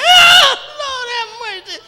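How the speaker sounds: wailing high and loud, voice breaking; demonstrating a midnight cry. Shown as a demonstration. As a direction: cry out to the Lord like a mourner in the night. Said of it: "You know how you cry with tears?".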